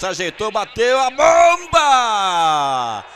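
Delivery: long, slow, roaring goal cry; goal call. Real, not acted. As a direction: stretch the goal shout out. Second goal, long shouted call.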